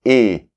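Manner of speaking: E said as a closed é; E pronounced tight, like the é in été; closed é sound. Shown as a demonstration. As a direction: say E as French é, not eu. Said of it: "il n'est pas non plus comme la lettre E".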